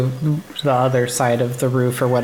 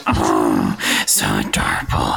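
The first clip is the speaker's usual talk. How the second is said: doting. Real, not acted. Melting over the good dog.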